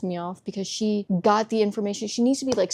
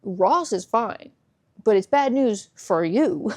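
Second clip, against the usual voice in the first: slow bad news; 4 dB louder, slower than usual. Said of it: "They're like".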